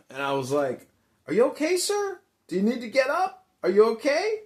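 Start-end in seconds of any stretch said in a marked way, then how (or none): 1.3-4.4 high, loud questions rising at the end; hammed-up urgent concern; concerned bystander. Storyteller replays checking on a fallen man, concerned.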